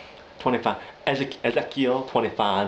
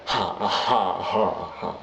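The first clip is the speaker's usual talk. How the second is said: slow, spaced-out fake laugh; dry, forced 'ha' one at a time; imitated Joker laugh; 2 semitones lower, much slower than usual. Attempting the Joker's laugh, dry mock laugh. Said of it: "I can't do the laugh right".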